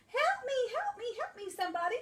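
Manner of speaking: high, loud cries for help; calling for help. Acted. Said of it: "he was screaming".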